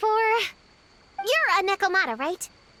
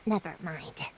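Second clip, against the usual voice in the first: sighing resignation; 11 semitones lower, 8 dB quieter than usual. Acted. Gives up correcting the "kid" remark.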